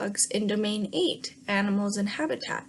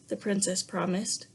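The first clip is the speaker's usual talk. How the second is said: plain reading voice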